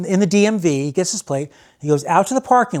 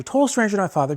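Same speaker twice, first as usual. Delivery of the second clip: ordinary talk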